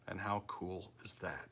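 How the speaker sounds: hushed, conspiratorial whisper. Real, not acted. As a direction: breathe it out like a secret thrill. Aside after boasting about car.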